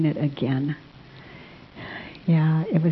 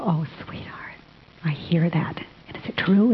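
put-on whispering to child. Mother whispering comfort to her hitting child. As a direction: lean in and whisper it tenderly.

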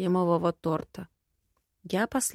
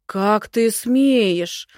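very slow, loud indignation; drawn-out, offended bluster; outraged old man; 2 semitones higher, 8 dB louder, much slower than usual. A narrator's reading. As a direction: stretch every word in loud outrage. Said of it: "снова возбух дед".

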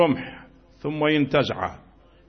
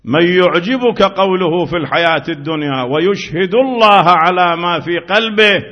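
preach; 4 semitones higher, 7 dB louder than usual. Acted. Recites verse loudly.

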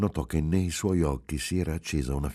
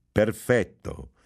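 slow, drawn-out PERFETTO, raised; mock-triumphant exclamation; shrill triumphant cry; 4 semitones higher, 4 dB louder, much slower than usual. A narrator's reading. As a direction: savour the catch. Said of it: "gridò con voce stridula e trionfante".

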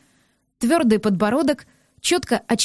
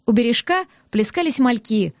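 ordinary delivery, nothing marked

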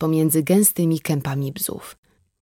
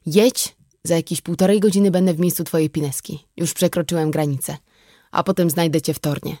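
ordinary delivery

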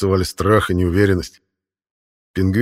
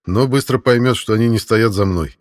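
ordinary delivery, nothing marked

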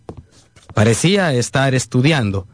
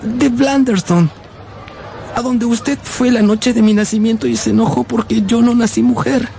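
put-on young boy voice; 8 semitones higher than usual. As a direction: play a small timid boy meeting his aunt. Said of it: "y le dije".

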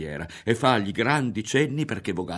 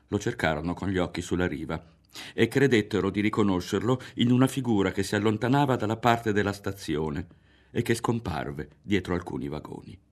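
ordinary delivery

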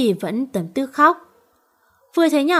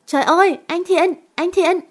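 higher, louder panicked calling; terrified scream; 7 semitones higher, 3 dB louder than usual. Acted. Quế Mai sees Thiện carried off, terrified scream. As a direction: scream his name in terror. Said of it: "hét lên khiếp đảm".

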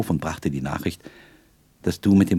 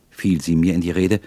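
usual speaking voice

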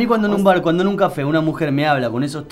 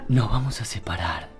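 low, soft and seductive; 7 semitones lower, 6 dB quieter than usual. Retold or imitated. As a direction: murmur it like a lover. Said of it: "usted cuando se separa le dice". (voz baja y seductora)

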